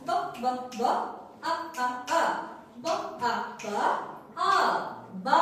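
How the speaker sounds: ব and আ in short separate beats, then blended into বা; phonics sounding-out. Shown as a demonstration. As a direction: chant ব, ব, ব, আ, আ, আ, then join as বা. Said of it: "এভাবে বলবো আমরা".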